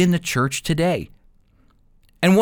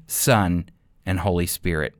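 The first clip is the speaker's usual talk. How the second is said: usual speaking voice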